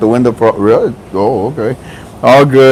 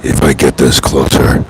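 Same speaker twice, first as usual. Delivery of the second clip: playful low growl into the mic; deep mic voice; 13 semitones lower than usual. Pretend. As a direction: drop the voice way down, booming.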